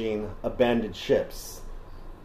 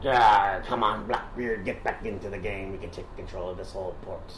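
lower, softer persuasive pirate; low coaxing voice; 5 semitones lower, 6 dB quieter than usual. Retold or imitated. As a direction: coax him in a low murmur. Vane voiced, low and quiet.

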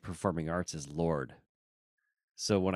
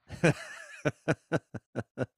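laughing along at the joke; a real, easy laugh; 7 semitones higher than usual. Real, not acted. Laughing at Russian bit.

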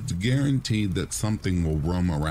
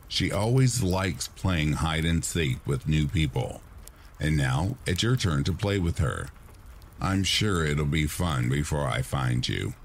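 ordinary delivery

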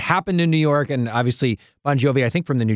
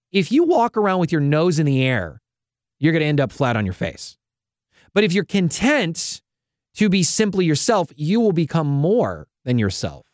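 ordinary delivery

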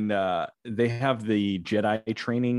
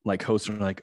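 ordinary talk, nothing marked

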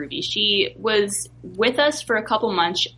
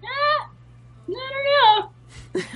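high, coy, playing modest; mock innocent shrug in a high voice; coy downplaying; 3 dB louder, much slower than usual. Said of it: "it's like".

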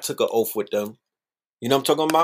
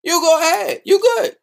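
squeaky falsetto teasing, much higher and louder; mock high taunt; 4 dB louder than usual. Taunting doctors to take the shot first, squeaky falsetto mock. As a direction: dare them in a tiny mocking voice.

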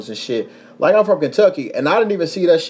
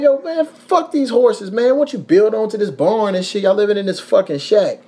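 mock advice; 6 semitones higher than usual. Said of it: "I'm like".

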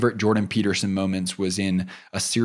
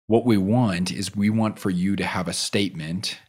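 ordinary delivery

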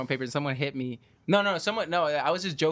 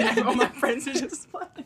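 ordinary talk, nothing marked

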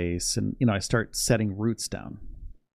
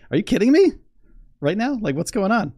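much higher, louder joyful outburst; excited disbelief; 10 semitones higher, 4 dB louder, faster than usual. Retold or imitated. Retelling to viewers how he reacted, excited. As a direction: squeal the disbelief. Retold reaction to her proposal.

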